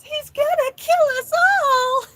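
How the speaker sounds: very high, loud, stretched-out wail; mimicked shriek of terror, words drawn out; imitated wailing scream. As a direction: wail it like a panicked little kid. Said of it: "wailing into the house".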